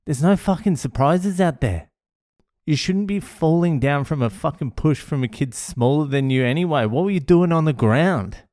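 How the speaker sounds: high-pitched exasperated rebuke; retold yelling. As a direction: tell the boy off, indignant. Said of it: "and I'm yelling".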